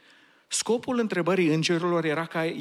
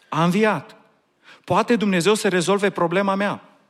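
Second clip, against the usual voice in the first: loud, rising preacher's questions and answers; preaching at full force; 4 semitones higher, 5 dB louder than usual. Acted. Preacher pressing the congregation with rhetorical questions, loudly. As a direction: build it up and proclaim.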